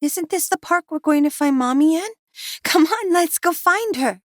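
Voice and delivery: put-on high, eager child's voice; a small girl tugging at her daddy; child voice, bright and pleading; little girl asking. A narrator's reading. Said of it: "she asked".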